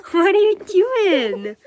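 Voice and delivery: high, slow and loud with a laugh running through it. Real, not acted. Mom laughing at baby.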